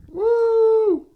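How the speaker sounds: high, excited whoop; excited cheer. Real, not acted. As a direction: cheer for the fighter. Cheering at fight card.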